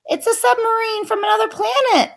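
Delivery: high, fast panicked shriek. A narrator's reading. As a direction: squeal it in terror. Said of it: "shrieked the coach".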